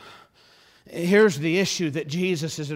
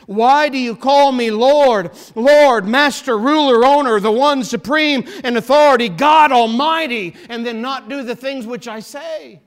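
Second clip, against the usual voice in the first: emphatic preaching; 8 semitones higher, 11 dB louder than usual. Acted. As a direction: thunder each title of the Lord, easing at the end. Preacher repeats Christ's question with full emphasis.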